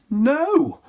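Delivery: high loud scream. Acted. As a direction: yell it in horror. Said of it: "The prince screamed".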